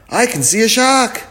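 put-on high, loud child's cry; alarmed cry. Wilma spots a shark, high loud alarm. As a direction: cry out at a sudden sighting.

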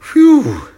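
loud, high whew of relief; relieved "whew". Acted. Wilma after the near miss, loud relieved whew. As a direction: let out a big high whew.

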